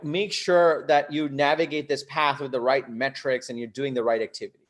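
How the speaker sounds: usual speaking voice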